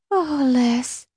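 a high, lightly sighed name; sighing. A narrator's reading. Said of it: "she sighed".

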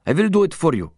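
put-on high, accented urgent offer; urgent cry. A narrator's reading. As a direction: cry it out to calm him. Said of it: "Mahmoud cried".

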